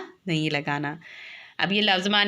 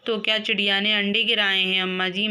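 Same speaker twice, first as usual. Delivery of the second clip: normal talking voice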